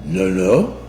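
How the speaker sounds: lightly put-on French accent, clerk at the counter; mock French accent. Mother imitating French car rental clerk, light French accent. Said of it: "your French accent".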